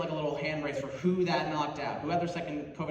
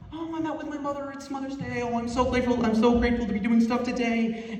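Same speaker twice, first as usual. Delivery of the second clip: higher, gushing mock-grateful voice; mocking Instagram posts; 6 semitones higher, 4 dB louder than usual. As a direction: parody sappy Mother's Day posters. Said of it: "everyone's going like".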